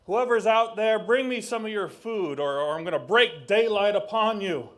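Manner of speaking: loud, high threatening call into the dark; demanding shout. Acted. Raven shouting at unseen fishermen for food, loud threat. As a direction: bellow a threat at unseen strangers.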